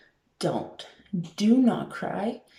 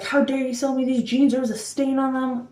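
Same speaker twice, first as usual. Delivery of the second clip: higher, mock-indignant complaint; imitated outraged customer; 6 semitones higher than usual. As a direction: ham up the customer losing it over a stain. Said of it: "freaking out".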